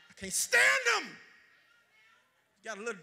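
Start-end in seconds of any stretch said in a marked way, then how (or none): 0.1-1.2 higher, louder shout, STAND hit hard. Real, not acted. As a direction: let it burst out of you. Preacher shouts his disgust.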